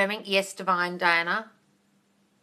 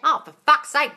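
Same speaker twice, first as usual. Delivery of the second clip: louder, higher exasperated outburst; 6 semitones higher, 6 dB louder than usual. Real, not acted. Exasperated at viewers calling it a chore. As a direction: throw your hands up.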